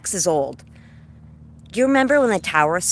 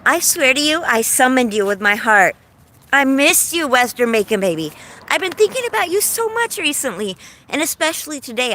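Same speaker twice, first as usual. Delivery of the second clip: higher-pitched, gushing delight; excited greeting; 7 semitones higher, 4 dB louder than usual. Real, not acted. Streamer to best friend who just joined, excited greeting.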